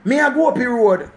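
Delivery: put-on a small child telling you where he's going; a hint of a little kid chattering; child speaking patois. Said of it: "when you hear the picnic say".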